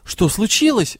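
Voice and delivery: plain reading voice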